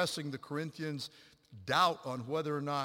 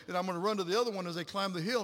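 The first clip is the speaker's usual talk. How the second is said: ordinary delivery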